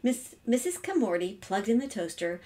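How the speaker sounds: ordinary delivery, nothing marked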